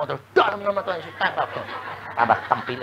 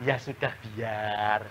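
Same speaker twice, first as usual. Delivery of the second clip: resigned giving in; 8 semitones lower, 14 dB quieter, slower than usual. Retold or imitated. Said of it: "dimaki-maki suami".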